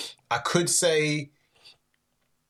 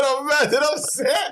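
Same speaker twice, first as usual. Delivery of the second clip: high mock quote; 12 semitones higher, 4 dB louder than usual. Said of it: "Man said".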